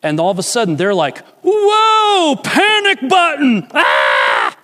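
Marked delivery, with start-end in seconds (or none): 1.4-4.6 much higher, loud, frantic shout; hammed-up terrified yell; panicked scream. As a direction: scream like soldiers hitting the panic button. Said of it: "got into the scream".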